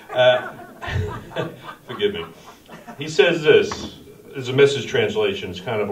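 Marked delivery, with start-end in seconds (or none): 0.5-1.8 1 soft laugh. Real, not acted. Laughs at own rebuke.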